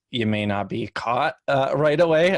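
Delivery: ordinary talk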